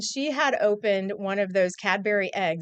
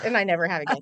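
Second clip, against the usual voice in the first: usual speaking voice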